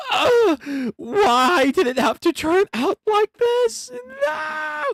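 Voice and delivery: high wailing cry of despair; anguished wail. Acted. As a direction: shriek it in anguish. Character's dramatic despair.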